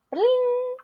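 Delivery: very high sung "Bling!" like a lightbulb going on; cartoon light-bulb chime; bright ding sound. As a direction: make the idea-sound. Idea sound effect.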